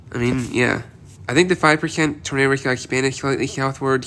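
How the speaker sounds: ordinary talk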